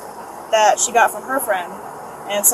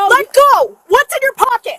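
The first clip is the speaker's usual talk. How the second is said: sharp, much higher, louder yell; shouted command; 12 semitones higher, 8 dB louder than usual. Real, not acted. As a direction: yell it mid-struggle. Officer struggling with suspect.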